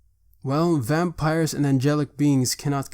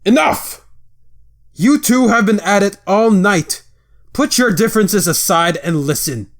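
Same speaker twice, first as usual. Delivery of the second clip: furious shout; 8 semitones higher, 9 dB louder than usual. Acted. Father cuts off his bickering sons with a furious shout. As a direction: roar it out, much higher and louder.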